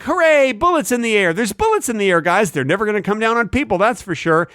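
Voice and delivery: high, naive celebrating voice; mock gleeful cheering. Mocking revelers who shoot into the air, gleeful cheer. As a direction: cheer it in hammed-up delight.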